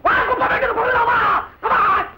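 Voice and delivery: high, angry dare; challenging shout. Acted. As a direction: shout him out to a fight. Challenges opponent to fight.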